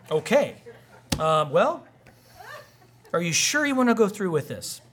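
hesitate, then question it in disbelief; taken-aback judge. Acted. Judge hearing a churchgoer sue after the sermon, taken aback.

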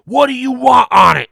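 shouted high and loud in an annoyed clerk voice. Retold or imitated. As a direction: bark the question at the deaf old guy. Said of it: "so she says after the second try".

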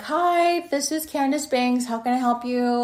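high, sing-out phone hello; polite phone greeting. Retold or imitated. Answering an unknown caller she suspects is detectives, high polite greeting.

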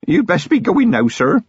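put-on a nervous young local constable, polite; young constable Feeney. A narrator's reading. Young constable urging Commander Vimes to leave, earnest and polite.

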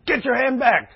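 loud, high shouted warning; retold half scream. Minister to a guy reaching near a mower, screamed warning. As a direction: yell it sharply, as in retelling a near accident. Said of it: "I kinda screamed at one of the guys".

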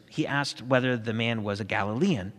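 ordinary delivery, nothing marked

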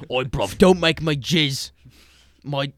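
mock British accent. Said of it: "Definitely be British".

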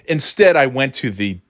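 ordinary talk

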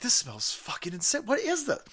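retold amazement, rising high at the end; amazed disbelief. Host retells sniffing the mystery smell, amazed disbelief. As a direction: act out being floored by a smell.

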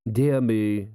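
ordinary delivery, nothing marked

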